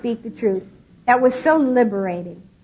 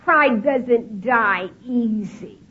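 normal talking voice

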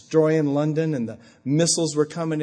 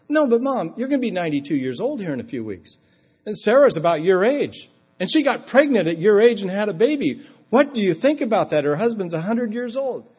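higher, louder, coaxing his mother along; raised voice to mom; 7 semitones higher, 5 dB louder than usual. Retold or imitated. Son coaxing his elderly mother, higher and louder. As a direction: speak up so an old mother hears.

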